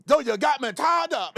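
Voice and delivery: high, loud hammed-up activist shout. Host mocking the congressman again, shouted old activist parody. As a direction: holler it like a mock old-time orator.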